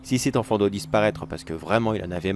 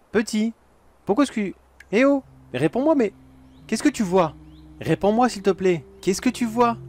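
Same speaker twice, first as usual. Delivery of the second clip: alarmed calling; 10 semitones higher than usual. Acted. Calling up to the silent child, high alarmed pleading. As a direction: call up to him, getting worried. Child not answering at top.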